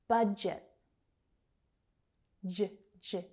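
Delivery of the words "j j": "J, j" as short juh, juh; isolated J sound. Shown as a demonstration. Teacher isolating the consonant, "J, j" as juh. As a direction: voice each "J" on its own.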